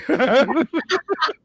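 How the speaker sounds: a sudden high, loud laugh; high burst of laughter. Real, not acted. Laughing at her own joke.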